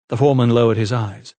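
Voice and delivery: ordinary delivery, nothing marked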